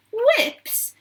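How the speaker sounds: very high, startled exclamation; astonished cry. A narrator's reading. Veruca reacting to the sign on the door, astonished cry. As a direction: echo the word in shock. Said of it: "cried Veruca Salt".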